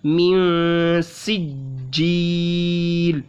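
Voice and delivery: मियाँ hummed through the nose into सिज्जील; slow, nasal मियाँ; nasal ghunna. Shown as a demonstration. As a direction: ghunna then light सीन. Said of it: "नाक में यहाँ पर गुन्ना ले जाना है".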